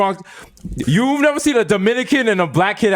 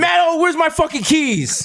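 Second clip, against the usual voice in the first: yelling; 10 semitones higher, slower than usual. Pretend. Acting son yelling at mom.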